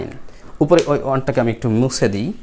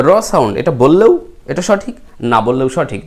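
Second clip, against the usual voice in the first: normal talking voice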